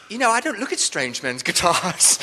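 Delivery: prim British brush-off; British accent. Retold or imitated. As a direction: imitate a snubbing Englishwoman, British accent. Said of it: "with this amazing British accent".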